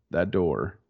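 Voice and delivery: ordinary talk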